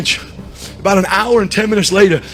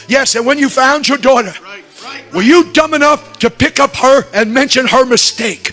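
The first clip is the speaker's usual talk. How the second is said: raised, loud pulpit delivery; impassioned preaching; 7 semitones higher, 4 dB louder than usual. Retold or imitated. God questioning the preacher about his found daughter, impassioned preaching. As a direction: preach it high and forceful.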